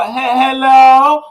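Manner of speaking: put-on high, halting old-lady hello; imitating granny. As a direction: stutter hello like a grandma straining to hear. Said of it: "She gonna be like".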